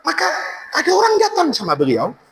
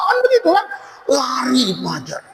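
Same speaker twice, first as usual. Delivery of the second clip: usual speaking voice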